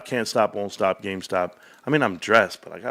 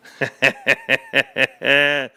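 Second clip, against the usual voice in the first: loud staccato cackle; delighted laugh; 4 semitones higher, 5 dB louder than usual. Real, not acted. Streamer rereading his own joke, delighted laugh. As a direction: burst out in rapid, loud ha-ha-ha.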